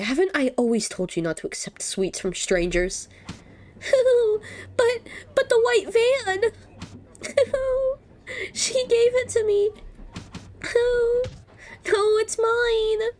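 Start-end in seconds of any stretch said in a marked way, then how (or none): 3.8-13.1 put-on high, whiny little girl wailing; bratty child clinging to her candy; pitched-up tantrum voice with sobbing noises; Maria's voice. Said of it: "doing Maria's voice".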